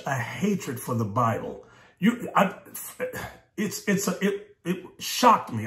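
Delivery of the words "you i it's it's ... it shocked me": halting, broken false starts; stuttering. Real, not acted. Preacher too shocked at the sisters' hatred, stuttering. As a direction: stumble over the words, restarting. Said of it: "I'm stuttering here".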